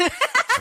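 high, loud burst of laughter; real laugh. Real, not acted. Woman amused by her own comeback, laughing high. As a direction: laugh out at your own joke.

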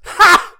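sharp loud scoffing "Ha!"; scornful laugh. Retold or imitated. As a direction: bark out a mocking laugh. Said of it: "the doctor just kinda goes".

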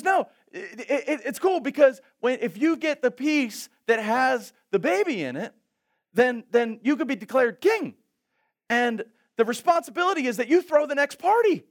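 high, loud, laughing explanation; laughing excited friend. Retold or imitated. Friend laughing while explaining the king cake rules. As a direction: chuckle through the rules.

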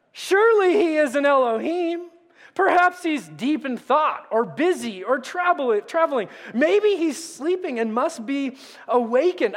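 taunting. A narrator's reading. Elijah mocks the prophets of Baal, sarcastic. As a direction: needle them about their sleeping god. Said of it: "Elijah began to taunt them".

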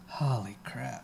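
whispered. Real, not acted. Reacting to sour taste.

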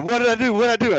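high-pitched panicky repeat, retold; mock panic. Pilot in a skirt panics, thinking the FAA wants him, high-pitched. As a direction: squeak it out like a worried pilot.

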